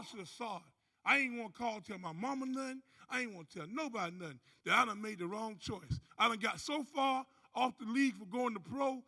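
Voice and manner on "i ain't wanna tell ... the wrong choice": ordinary talk, nothing marked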